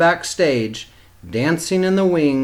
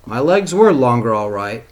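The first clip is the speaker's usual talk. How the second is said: ordinary delivery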